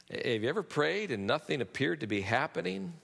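ordinary talk, nothing marked

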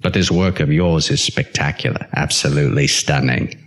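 low, menacing thespian impression; Tony Hopkins. Hopkins writing his admiring letter, low Hannibal-like impression. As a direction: intone it low, like a sinister British actor. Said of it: "You can hear him going".

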